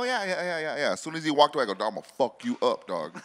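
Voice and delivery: ordinary talk, nothing marked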